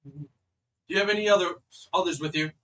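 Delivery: loud guard interrogating strangers; called-out questioning. Acted. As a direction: demand an answer from up on the wall.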